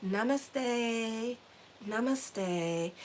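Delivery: slow, soothing guru-style delivery; mock serene calm. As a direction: play the newly peaceful yogi. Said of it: "And now I'm like".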